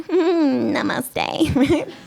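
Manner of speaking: high, airy mock namaste; hum, then greet like a yoga devotee; yoga girl. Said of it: "she's that girl on the plane".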